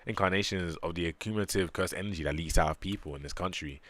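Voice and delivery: ordinary talk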